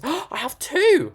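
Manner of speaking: high, drawn-out delighted burst; gasp, then exclaim with delight; excited discovery. Real, not acted. Delighted discovery while building.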